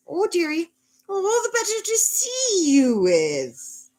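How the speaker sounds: very high, drawn-out fake-granny falsetto; big bad wolf. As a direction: coo it as a wolf pretending to be Grandma. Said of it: "the big bad wolf says".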